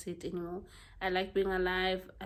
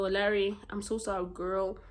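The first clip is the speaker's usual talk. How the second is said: ordinary delivery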